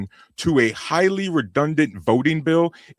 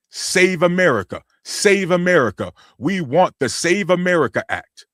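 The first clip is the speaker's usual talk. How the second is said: higher-pitched imitation of people demanding; mock chant, pitched up; demanding crowd, quoted; 7 semitones higher than usual. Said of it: "The people are demanding it".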